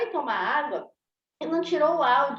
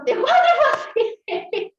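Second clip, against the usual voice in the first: rindo; 10 semitones higher, 6 dB louder, slower than usual. Real, not acted. Ri da própria situação.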